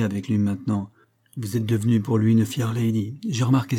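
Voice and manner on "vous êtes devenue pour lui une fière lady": plain reading voice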